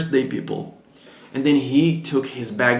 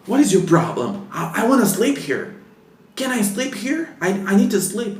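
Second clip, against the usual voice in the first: imitating an angry guest; 8 semitones higher than usual. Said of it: "he was so angry".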